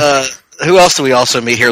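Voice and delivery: ordinary talk, nothing marked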